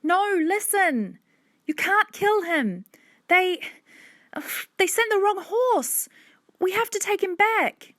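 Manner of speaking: high, urgent, faltering plea; very high girl's voice, insistent; pleading child. A narrator's reading. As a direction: beg desperately, breaking off and restarting. Hannah begs for the horse.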